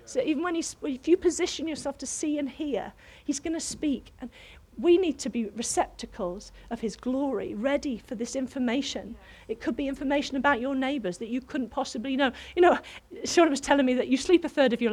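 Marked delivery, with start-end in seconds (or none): none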